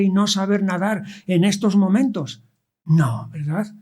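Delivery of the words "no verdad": ordinary delivery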